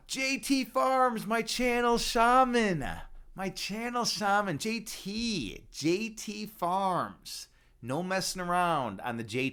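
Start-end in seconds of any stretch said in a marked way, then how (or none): 0.1-7.5 high, drawn-out hailing call; excited shout-out. Real, not acted. Streamer hails a favorite viewer joining chat, excited shout-out. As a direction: stretch each word out in big, delighted welcome.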